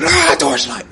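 high-pitched mock shriek; jokey, high cry of alarm; mock scream. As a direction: squeal the word in pretend horror. Joke scream at the wrong torchlight.

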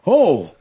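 friend's startled yelp in the front seat; retold startled yelp. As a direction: imitate a sudden startled "oh" at a near crash. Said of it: "And his friend goes".

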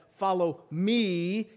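slow, with "me" stretched out; stress on "me". Shown as a demonstration. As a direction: draw "me" out long and lifted. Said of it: "we're gonna start with me".

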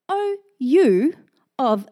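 slow, weighty Bible reading; scripture reading. Acted. Pastor reads the storm story aloud, slow and weighty. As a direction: read slowly, letting each phrase land.

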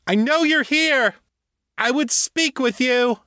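put-on high, demanding young woman's shout; indignant, ringing high-pitched challenge; angry shouting. A narrator's reading. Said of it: "Mirai shouted again".

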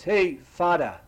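calling out. Retold or imitated. Boy calls to priest from behind, loud call. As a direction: hail the priest from across the parking lot.

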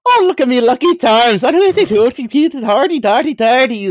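high, loud cartoon leprechaun; Lucky Charms leprechaun. Guest imitates a voice actor from a con, cartoon leprechaun voice. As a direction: pitch it up into a hammy Irish leprechaun. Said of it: "did a Leprechaun voice. The Lucky Charms".

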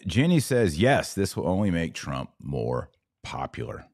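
usual speaking voice

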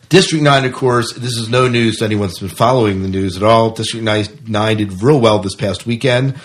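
ordinary talk, nothing marked

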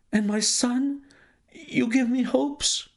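high, anguished banker's lament; banker crying out. Acted. The despairing banker to Holmes, high anguished cry. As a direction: wail it as all hope goes.

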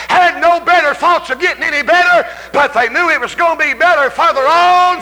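high, loud shouted preaching; full-force preaching. Real, not acted. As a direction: preach it to the rafters.

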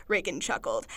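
ordinary talk

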